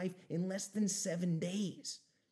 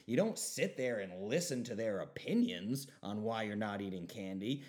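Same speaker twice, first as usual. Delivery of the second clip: ordinary talk, nothing marked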